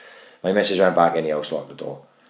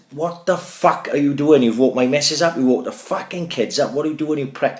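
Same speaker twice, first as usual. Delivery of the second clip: retold rant; 7 semitones higher than usual. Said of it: "And I'm screaming at him".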